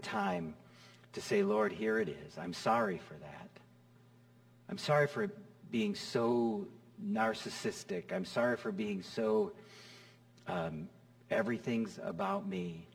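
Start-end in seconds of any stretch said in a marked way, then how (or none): 1.4-12.9 quiet confession prayer. Pretend.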